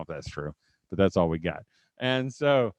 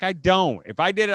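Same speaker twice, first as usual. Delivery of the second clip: higher, louder protest; mock indignant denial; 7 semitones higher, 6 dB louder than usual. Husband denying the lip thing to his wife, indignant. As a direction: re-enact a husband defensively insisting.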